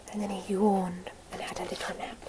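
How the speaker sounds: hushed bedtime whisper; sleepy whisper. A narrator's reading. Caterpillar falls asleep.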